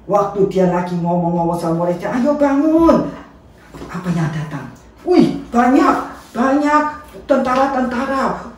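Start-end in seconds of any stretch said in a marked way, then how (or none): 2.1-3.1 high, loud and slow call to rouse sleepers; slow, raised wake-up call; calling out to wake them. Acted. Jesus waking the disciples. 5.0-8.5 alarmed excitement. A narrator's reading. Soldiers arrive, excited exclaim.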